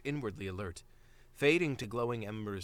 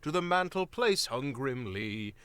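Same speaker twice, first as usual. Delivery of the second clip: a lightly voiced old storyteller, slower and louder toward the end; ominous old rhyme; 6 semitones higher, 5 dB louder than usual. A narrator's reading. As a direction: tell the warning like a grim old grandfather.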